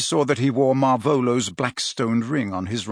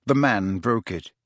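plain reading voice

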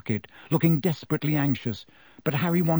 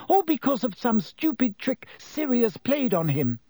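put-on higher, pleading girl's voice; desperate Hermione; 10 semitones higher than usual. A narrator's reading. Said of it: "Hermione said desperately".

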